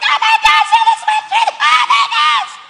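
very high, loud squealing fangirl impression; mock excited fan. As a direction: squeal it like a hammed-up starstruck fan. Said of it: "You would get so excited".

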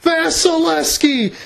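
shouted goal call, voice cracking. Real, not acted. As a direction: voice cracking on a high excited call. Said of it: "I'm sorry about the voice cracks".

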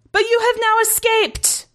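very high, slow alien commander; insistent commander. A narrator's reading. Commander questioning escaped prisoner, insistent. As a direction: press the point high and slowly.

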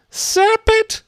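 very high, loud pigeon shriek; piercing high cry; screamed. Acted. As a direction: scream it as an accusation. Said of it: "screamed the pigeon".

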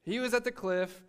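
normal talking voice

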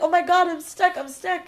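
high-pitched mock alarm; retold panic. Rider telling the woman she is stuck, mock panic. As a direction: replay your flustered plea for help.

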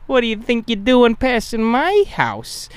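high, slow menacing tough voice; tough kid voice. Retold or imitated. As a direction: threaten the passer-by. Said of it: "He's like".

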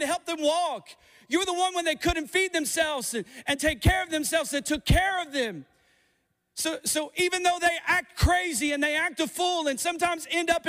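rising preacher's appeal, pitch climbing; preaching. Acted. Preacher pleading with parents in the congregation, rising fervor. Preacher builds to full force.

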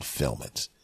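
a hushed low murmur, like a thought; quiet inner voice. Retold or imitated. The little voice in his head urging him, hushed. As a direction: whisper it to yourself, low and soft. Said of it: "that little voice in my head that said".

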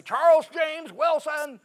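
high, loud, slow shout of a full name; mother yelling her son's full name from across the house; mother hollering full name. Retold or imitated. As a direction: bellow it like an angry mom calling her son. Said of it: "my mother hollered at me".

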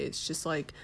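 usual speaking voice

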